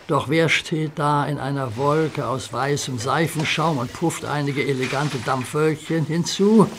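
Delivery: usual speaking voice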